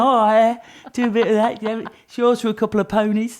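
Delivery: mock London market spiv sealing a deal; Del Boy. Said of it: "it's a bit Del Boy".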